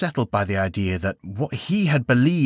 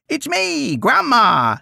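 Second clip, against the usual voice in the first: grandma voice; much slower than usual. Acted. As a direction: blurt it out in your grandma impression. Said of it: "He tried the voice again".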